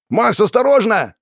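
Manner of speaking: high, loud, urgent cry; warning shout. A narrator's reading. Joseph warns his partner of the demon, urgent shout. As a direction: shout a sudden warning to a comrade. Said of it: "Крик Джозефа".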